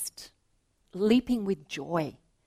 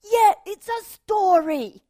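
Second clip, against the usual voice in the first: put-on much higher, louder excited child; relieved eager boy; 11 dB louder than usual. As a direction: jump at it like a relieved kid. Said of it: "He said".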